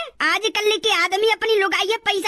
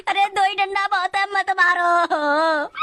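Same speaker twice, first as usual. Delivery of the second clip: wailed plea, last words dragged out slow; tearful begging; slower than usual. Acted. Prisoner begging policeman to stop beating, tearful begging. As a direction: cry out in mock agony, stretching the end.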